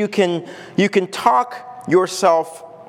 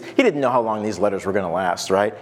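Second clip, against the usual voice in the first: usual speaking voice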